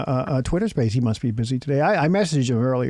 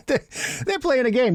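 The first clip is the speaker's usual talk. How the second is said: laughing; 8 semitones higher than usual. Acted. Laughing joke repeat.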